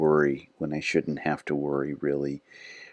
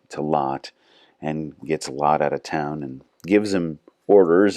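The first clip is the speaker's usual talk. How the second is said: ordinary delivery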